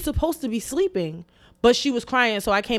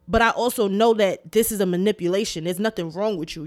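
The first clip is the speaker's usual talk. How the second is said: ordinary talk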